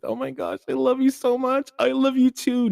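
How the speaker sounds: squealing high and loud, lovey-dovey phone talk; lovestruck couple. Acted. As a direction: coo like new lovers who won't hang up.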